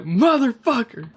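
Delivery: high-pitched startled curse; blurting it out as it all clicks; stunned realisation. Retold or imitated. Sudden realisation, retold reaction.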